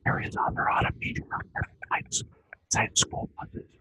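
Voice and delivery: soft unvoiced whisper. Real, not acted. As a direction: breathe the words with no voice. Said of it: "Are you whispering?".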